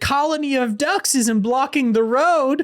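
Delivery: high put-on voice for a worried sheep; silly sheep voice. Podcast host voicing sheep blocking the road, high and silly. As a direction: squeak it out like a fretful farm animal. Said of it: "They're just like".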